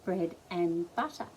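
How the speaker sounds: usual speaking voice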